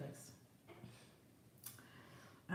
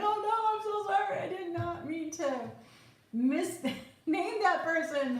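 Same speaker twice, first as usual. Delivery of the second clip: apologetic friend; 9 semitones higher than usual. Retold or imitated. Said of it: "people are like".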